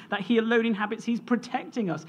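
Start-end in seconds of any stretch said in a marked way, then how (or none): none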